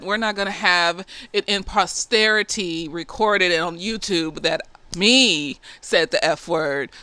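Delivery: usual speaking voice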